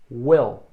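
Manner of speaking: Will said with a short clipped i like "wel". Shown as a demonstration. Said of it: "Repete comigo".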